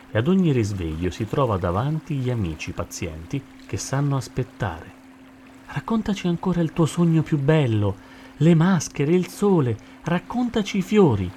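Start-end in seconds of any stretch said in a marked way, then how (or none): 5.7-11.3 high, eager request for a story; eager pleading. A narrator's reading. Friends asking sloth to retell dream, eager pleading. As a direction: coax with a lifted voice.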